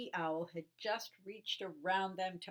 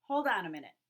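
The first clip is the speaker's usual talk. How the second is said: louder firm objection; protesting; 8 dB louder, much faster than usual. Acted. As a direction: stop them with a firm protest. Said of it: "protested Baby Owl".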